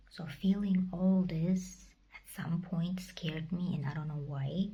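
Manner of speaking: ordinary delivery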